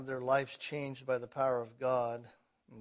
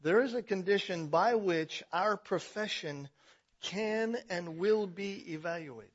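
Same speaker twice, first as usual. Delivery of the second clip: ordinary delivery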